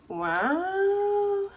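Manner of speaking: long, high, rising wow; drawn-out awe. Real, not acted. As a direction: slide up high and hold it. Admiring books just unboxed.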